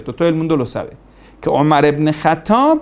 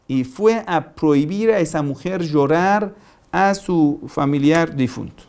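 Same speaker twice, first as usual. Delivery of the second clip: normal talking voice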